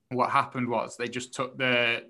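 usual speaking voice

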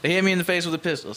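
a touch of a frantic victim; retold freaking out. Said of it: "crying and fucking freaking the fuck out".

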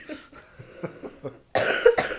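loud whispery choking cough; hacking cough on a drink. Real, not acted. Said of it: "I'm choking".

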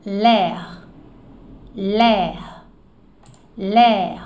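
l'air as one syllable LEHR; French l'air. Shown as a demonstration. Teacher drilling l'air for students. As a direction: say l'air clearly, held open vowel. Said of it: "O ar".

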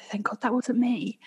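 breathy whisper to herself; whispered thought. Retold or imitated. Retold thought after the crash, whispered. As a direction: whisper it like a private relief.

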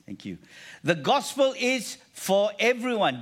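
normal talking voice

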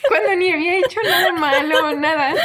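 words squeezed out through laughter; can't stop laughing while telling it. Real, not acted. Laughing at her own overreaction to the stranger.

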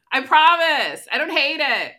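high, loud, insistent denial; emphatic protest. Real, not acted. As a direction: swear you mean it, too loudly. Exaggerated defensive denial.

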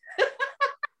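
real high-pitched laugh; laughing at the memory. Real, not acted.

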